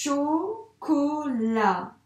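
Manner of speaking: chocolat, syllabes très étirées; détache chaque son en écrivant; dictée lente. Shown as a demonstration. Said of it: "je fais mes attaches avec le O". (mot étiré au ralenti pendant l'écriture)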